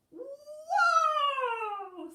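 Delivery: very high, loud amazed whoa; amazed squirrel. Acted. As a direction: gasp then cry out in wonder. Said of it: "said Squirrel".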